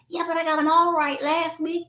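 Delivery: put-on high-pitched child voice; child's reply. Child answering a parent about last week's test, in child voice. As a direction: protest as a let-down child.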